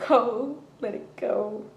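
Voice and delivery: slow, fading soothing voice; calm inner voice. Retold or imitated. Inner voice calms her down after the stabbing, slow and fading. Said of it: "all I can hear from that point was".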